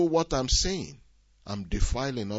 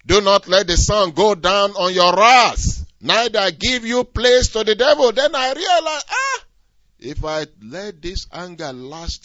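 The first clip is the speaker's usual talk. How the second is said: higher and louder, driving the warning home; preaching at full force; 6 semitones higher, 9 dB louder than usual. Acted. Preacher warning the congregation about anger, loud and high. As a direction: hammer the warning like a pulpit preacher.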